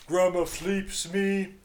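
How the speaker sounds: slow, high, loud creature gurgle; gurgling fish man croak. A narrator's reading. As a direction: drag it out like a blob monster. Creature's garbled speech.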